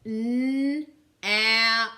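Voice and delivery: N then ap, said loud; segmenting sounds. Shown as a demonstration. Teacher segmenting nap for the class, loud and clear. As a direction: split nap into N and ap. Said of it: "Tell me the sounds we hear in nap".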